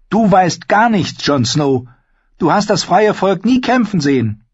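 put-on high, bold young wildling woman; spirited young woman, teasing; Ygritte voice. Narrator voicing Ygritte.

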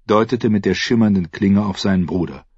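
ordinary delivery, nothing marked

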